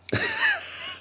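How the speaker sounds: a sudden high-pitched giggle; high squeaky giggle. Real, not acted. Real high giggle over the canvasser story. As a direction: let a squeal of laughter escape. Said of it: "I don't know why this is all funny".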